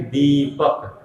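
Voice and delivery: दीपक with a stretched "dee"; long, unhurried vowel. Shown as a demonstration. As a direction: linger at ease on the ī of दीपक. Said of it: "आराम से".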